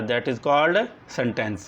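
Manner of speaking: normal talking voice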